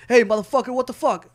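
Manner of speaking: loud, aggressive confrontation; hostile yell. Shown as a demonstration. Showing a buddy how the words sound hostile, a loud angry yell. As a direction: bark it like you want a fight. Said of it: "your action and emotion behind it".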